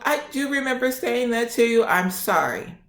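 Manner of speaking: high mock-weepy admission, falling at the end; tearful confession. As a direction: imitate her owning up through tears. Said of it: "And she started crying".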